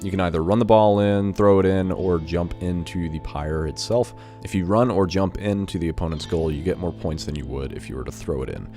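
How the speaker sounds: ordinary talk, nothing marked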